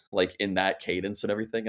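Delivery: ordinary delivery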